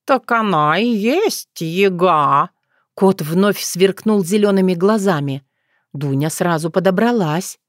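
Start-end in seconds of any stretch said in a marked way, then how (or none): 0.1-2.5 slow, drawling cat; talking cat. Acted. Cat pointing out the yaga to Dunya, slow and drawling. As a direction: stretch it out, stating the obvious as the cat.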